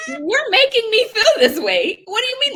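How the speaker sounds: 높은 목소리로 웃으며 따지기; laughing outrage. Real, not acted. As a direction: laugh through it, high and indignant.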